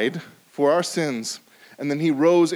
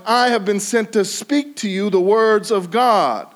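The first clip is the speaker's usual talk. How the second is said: angel's proclamation; 6 semitones higher, 6 dB louder than usual. Acted. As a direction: proclaim it like God's messenger. Preacher voices angel Gabriel.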